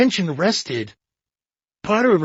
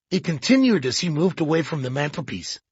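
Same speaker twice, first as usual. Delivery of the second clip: ordinary delivery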